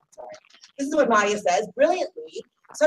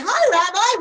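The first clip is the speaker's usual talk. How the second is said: a touch of mock cheer on the greeting; patronizing hello to an outsider; 8 semitones higher, 5 dB louder, slower than usual. Shown as a demonstration. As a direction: greet him in a lightly mocking, high voice.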